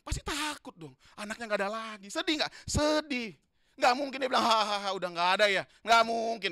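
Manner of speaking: loud, high, impassioned sermon; preaching at full force. Acted. Preacher stirring the church about the grieving father, loud and high. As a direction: drive it home with fervour.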